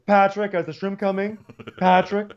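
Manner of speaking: high mock call to the waiter; Ramona. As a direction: call out for service in caricature. Playing Ramona calling the waiter.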